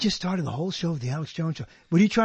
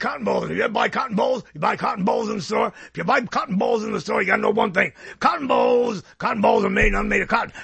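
raspy, emphatic ranting impersonation; throaty radio ranter with heavy stresses; Alex Jones; 2 semitones higher than usual. Acted. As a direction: rant from a vibrating throat, punching the words. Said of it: "You, you kinda have it".

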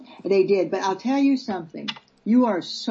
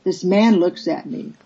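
ordinary talk, nothing marked

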